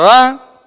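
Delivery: a sudden loud sung ah; sudden loud burst. Shown as a demonstration. Teacher showing a sudden crescendo, loud ah. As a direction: burst out on the ah like a swell. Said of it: "si yo en un mismo compás tengo otro".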